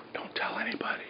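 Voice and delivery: breathy mock-secret whisper; stage whisper. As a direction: lean in and whisper it like a guilty secret. Secret confession aside to viewers.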